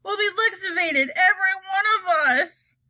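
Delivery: high-pitched grandma wail; high, dismayed storybook cry; cried in dismay. A narrator's reading. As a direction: wail it high, foretelling doom. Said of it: "cried Grandma Georgina".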